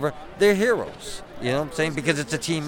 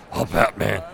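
low, brooding Batman; intimidating Batman voice; 4 semitones lower than usual. Acted. Batman addressing a kid, intimidating low voice. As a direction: drop into a low superhero growl to intimidate. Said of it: "they get really intimidated".

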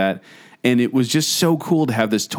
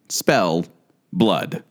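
lower, slightly ominous toy voice, words set apart; robotic voice; 4 semitones lower than usual. Retold or imitated. Co-host telling a scary story, imitating the toy's robotic voice. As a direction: imitate a talking spelling toy. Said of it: "this really robotic voice".

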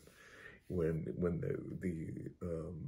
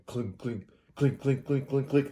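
imitated door clinking; 4 semitones higher than usual. Describing the door rattling to the host, clinking. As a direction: mimic a metal rattle, rising slightly.

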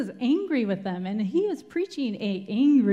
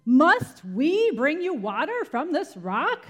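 angry; 6 semitones higher, 5 dB louder than usual. Acted. Moses' angry retort.

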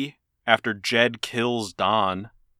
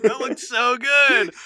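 gushing; 12 semitones higher, slower than usual. Retold or imitated. Imitating gushing commenters.

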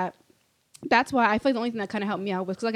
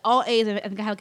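ordinary talk, nothing marked

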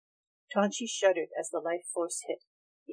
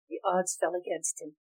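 usual speaking voice

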